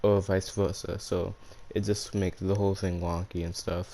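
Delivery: ordinary delivery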